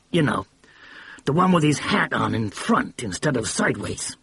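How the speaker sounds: a blustering mogul catching his mistake; excited brash producer. A narrator's reading.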